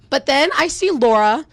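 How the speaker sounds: usual speaking voice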